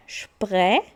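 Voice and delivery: spre said shpre with a throaty r; shpre sound. Shown as a demonstration. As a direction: build it up to shpre. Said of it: "Aí vai E".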